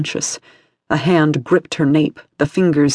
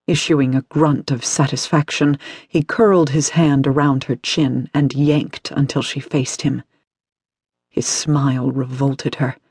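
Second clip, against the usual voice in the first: plain reading voice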